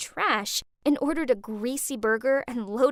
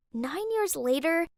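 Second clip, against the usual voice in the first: ordinary delivery, nothing marked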